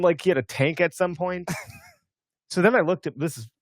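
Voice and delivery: ordinary talk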